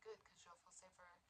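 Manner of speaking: whispered, cold reassurance; a retold hush. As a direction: whisper it as a dark hint. Cold reply to her.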